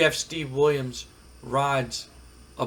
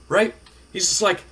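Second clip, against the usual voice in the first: usual speaking voice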